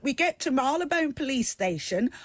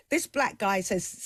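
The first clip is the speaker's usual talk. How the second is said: ordinary delivery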